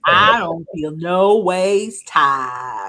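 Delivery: very slow, drawn-out pandering impression; mock folksy politician. Mocking a politician's put-on folksy speech.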